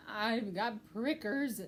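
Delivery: slow, grumbling critter voice; pack rat complaining. A narrator's reading. Pack rat telling Mouse about prickers, slow grumble. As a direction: complain slowly, drawn out.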